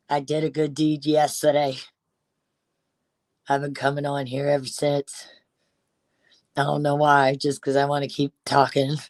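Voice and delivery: imitating Tink in a lightly put-on Cajun accent. Mocking Tink's livestream in a Cajun accent. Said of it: "That's my Cajun accent".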